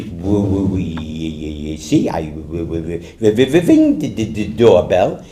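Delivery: low, heavily stuttered reply; hesitant stammer, stuck on first sounds; stuttering salesman. Acted. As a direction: stumble and repeat at the start of words. Stuttering salesman answers the president.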